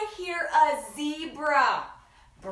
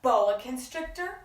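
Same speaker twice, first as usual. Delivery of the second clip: plain reading voice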